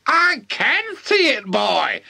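high screechy granny voice; high-pitched old girl's holler; shouting old woman. A narrator's reading. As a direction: shout back as a cranky granny. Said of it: "the old girl shouted back".

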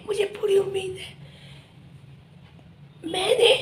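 normal talking voice

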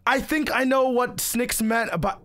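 ordinary delivery

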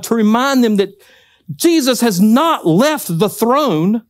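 rising, slow pulpit exhortation; preacher urging the men to stand by friends, building fervour; preaching. Acted. Pastor urging men to speak into lives, preaching fervently.

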